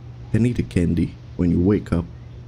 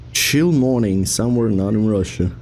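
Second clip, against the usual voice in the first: ordinary talk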